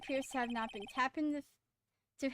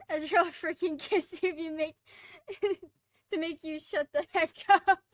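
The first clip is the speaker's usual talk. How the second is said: loud words broken by laughter; laughing while talking; 3 semitones higher, 6 dB louder than usual. Real, not acted. Player teasing about Ezra, loudly through real laughter. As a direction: push the joke out through a laugh.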